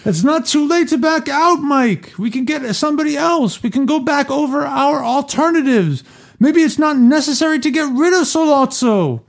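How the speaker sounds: silly high voice. Pretend. Reader trying out a funny high voice for Hagen. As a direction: ham up the reading in a high character voice. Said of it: "I'll try it, though".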